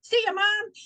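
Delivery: voiced little dog. Dog abandoning owner in rain, cheeky high voice. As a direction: toss it off as the fleeing pet. Voicing the dog bolting home.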